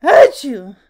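explosive sneeze mid-sentence; loud acted sneeze. A narrator's reading. Mister Sneeze cannot help it, loud sneeze. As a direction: interrupt yourself with a big fake sneeze. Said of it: "sneezed Mister Sneeze".

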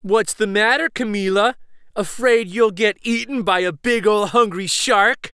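taunting. Acted. Classmate teasing nervous girl, loud taunt. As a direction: needle her, loud and sing-song. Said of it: "taunts Wyatt".

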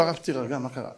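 calm him like a kind elder; gentle soothing. Retold or imitated. Rabbi calms a weeping young man, softly.

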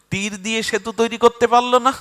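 high, loud jeering question; mocking taunt. Hanuman mocks the bow of Arjun's lord, loud taunt. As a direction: needle him about his lord.

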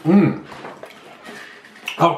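talking between bites; chewing while talking. Real, not acted. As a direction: mouth partly full. Seen 0: Chip dipped and eaten at -4; still chewing at 0.2-0.7.

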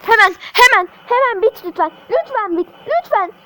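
desperate begging, high; frantic pleading. Real, not acted. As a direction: plead for it to end, fast. Begging game to end.

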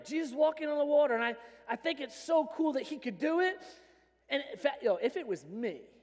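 ordinary talk

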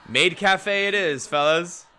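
mock-grand declaration, drawn out; hammed-up announcement. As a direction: announce it like a grand decree to the fellas. Joking announcement to chat.

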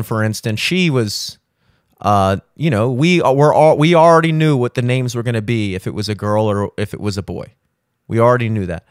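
ordinary talk

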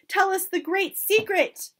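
high, loud pleading call. A narrator's reading. Witches begging for the secret, called out. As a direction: clamour to be told. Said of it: "they called out".

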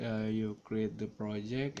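ordinary talk

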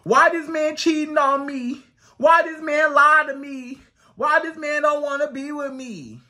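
hammed-up tearful complaining; mock whiny crying. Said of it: "you start crying".

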